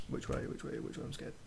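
breathless frightened whisper, lost; scared whisper. Real, not acted. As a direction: whisper it in panic, hiding. Lost and scared in horror game.

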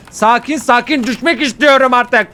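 high, loud frustrated outburst; loud, high-pitched exasperation; exasperated shout. Real, not acted. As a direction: yell it in gamer rage. Rage shout while playing.

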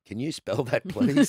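rising in disbelief, pitch climbing to a high please; asking politely but baffled, voice lifting up; incredulous question. Retold or imitated. Young lodger asks the old mayor to spell his name, baffled.

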